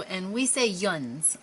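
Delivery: yuns said slow, drawn out as one word; Pittsburgh yuns. Shown as a demonstration. Vlogger showing the Pittsburgh word, slow and proud. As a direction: lean on yuns like a local. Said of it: "everybody else says you".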